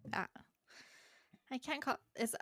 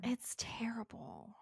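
whispering; 5 dB quieter, slower than usual. Real, not acted. Whispered complaint about cider.

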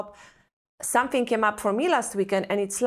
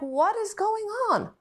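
high, startled inner question; panicked question; 10 semitones higher than usual. Retold or imitated. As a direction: ask it in sudden alarm, pitched up. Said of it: "And I'm like".

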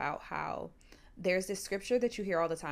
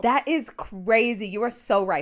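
much louder, fired-up agreement; loud excited agreement; 4 semitones higher, 12 dB louder than usual. Real, not acted. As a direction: blurt it out, outraged and thrilled. Agreeing hotly with cohost.

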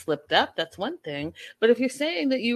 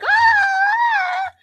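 high, loud fake crying wail; mock tantrum wail; 8 dB louder than usual. Imitating a child's attention-seeking tantrum cry. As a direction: wail like a kid throwing a tantrum. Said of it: "then it's all like".

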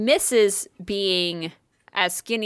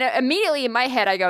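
normal talking voice

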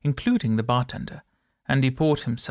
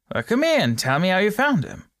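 louder, higher call across the street; calling bobby; 6 semitones higher, 4 dB louder than usual. A narrator's reading. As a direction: summon the little man firmly. Bobby calls to Andy.

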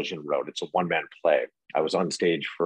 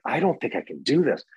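higher-pitched, mildly panicked protest; retold self-doubt; 7 semitones higher than usual. Actor recalling the night before a big role, mildly panicked. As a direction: imitate your own worried reaction, pitch lifted.

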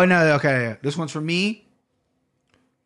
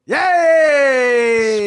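excited cheer; 7 semitones higher, 6 dB louder than usual. Real, not acted. Cheering at the reveal.

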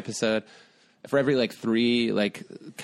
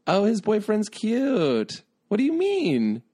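teasing; 10 semitones higher, 3 dB louder, slower than usual. Real, not acted. Teasing friend's reaction.

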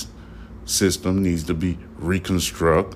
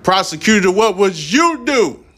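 raised challenge; 11 semitones higher, 4 dB louder than usual. Real, not acted. Speaker to the prosecutor, demanding an answer, high and loud.

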